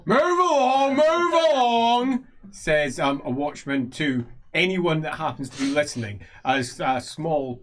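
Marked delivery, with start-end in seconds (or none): 0.1-2.3 loud, high, drawn-out street call; watchman's shout. Acted. Watchman to anyone listening as patrol passes, shouting. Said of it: "They're just shouting".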